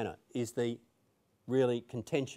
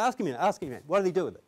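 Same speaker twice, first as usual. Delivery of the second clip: raised, higher mock-gangster demand; impatient order; 5 semitones higher, 5 dB louder than usual. Mister Big orders the lawyer to question the accountant, impatient. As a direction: act out a boss insisting on an answer.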